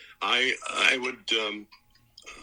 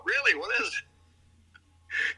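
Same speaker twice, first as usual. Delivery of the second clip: mock surprise; much slower than usual. Feigned surprise at daughter's show.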